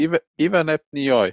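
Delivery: backwards phrase Ivan ebte nie Heu; played backwards. Shown as a demonstration. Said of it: "Wir haben das doch gesagt".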